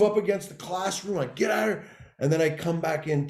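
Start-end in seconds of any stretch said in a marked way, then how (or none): 1.4-1.8 high-pitched mock throw-out; retold screaming. Teacher throwing student out of class, high-pitched mock yell. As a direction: raise the pitch and order them out. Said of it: "We start screaming".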